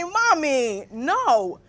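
dropping low in patient mock correction; mock grammar correction. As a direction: gently lay down the law to a parent. Corrects mother's grammar in acted exchange.

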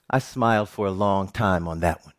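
usual speaking voice

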